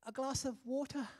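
"water" in soft British style, t said clean and no r; wife repeats her order in a light British accent. Retold or imitated. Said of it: "And Leah will say".

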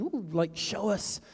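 ordinary talk, nothing marked

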